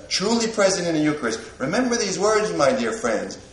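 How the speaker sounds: usual speaking voice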